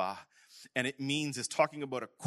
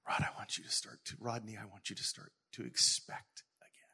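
whispered; 6 dB quieter than usual. Retold or imitated. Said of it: "he says".

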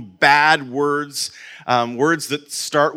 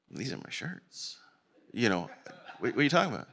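confused; 4 semitones lower, 13 dB quieter, faster than usual. Retold or imitated. Confused reply to store clerk.

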